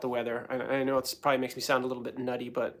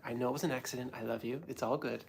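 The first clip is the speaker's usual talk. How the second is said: reassuring dad; 9 dB quieter than usual. Retold or imitated. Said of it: "and I was like".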